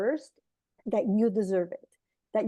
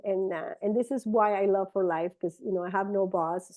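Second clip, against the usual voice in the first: ordinary delivery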